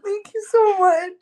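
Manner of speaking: high, sobbing disbelief; overwhelmed and weeping, can barely speak; real tears, pitch high and breaking; crying. Real, not acted. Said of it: "I'm crying like a baby".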